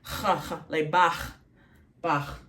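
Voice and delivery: throaty scraped 'kh' in CHA and BACH; guttural chaf. Shown as a demonstration. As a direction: rasp the CH at the back of the throat. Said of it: "that kind of sound".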